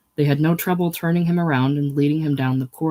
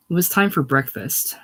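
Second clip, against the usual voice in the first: plain reading voice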